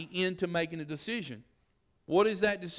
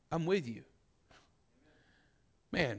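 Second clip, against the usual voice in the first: usual speaking voice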